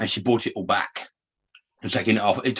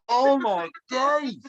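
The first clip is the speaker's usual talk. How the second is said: much higher and very slow, drawn-out dismay; 12 semitones higher, much slower than usual. Retold or imitated. Mocking his own reaction to friends, drawn-out dismay. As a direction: stretch it out in mock despair. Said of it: "I'm like".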